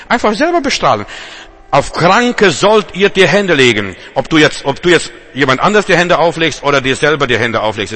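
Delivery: fiery sermon shout; fervent preaching. Acted. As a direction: proclaim the command with fervour. Preaching at full force.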